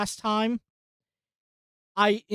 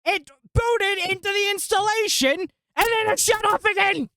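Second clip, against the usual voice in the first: much higher, louder, squeaky with disbelief; exasperated outburst; 13 semitones higher, 3 dB louder than usual. Real, not acted. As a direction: cry out in exasperation at the crash. Computer crashed, exasperated outburst.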